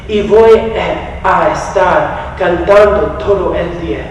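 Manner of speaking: heartfelt Spanish lyric reading; poem recitation. Acted. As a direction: recite the lullaby words like a poem. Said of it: "this was how the translation goes".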